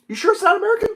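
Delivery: high, doubtful question; really, are you sure?; incredulous. Real, not acted. Doubting listener's claim.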